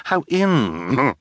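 high, loud theatrical delight; over-the-top villainous glee; gushing villainess. A narrator's reading. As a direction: exult with gasps in a high affected voice. Villainess exults, practises new laughter.

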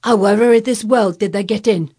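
baffled gardener's loud question; bursting with curiosity. A narrator's reading. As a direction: blurt it out, overcome with curiosity. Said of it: "burst out because he was overpowered by curiosity".

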